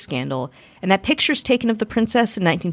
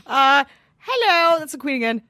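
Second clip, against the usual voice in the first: mock queen; 7 semitones higher than usual. Mock queen greeting again.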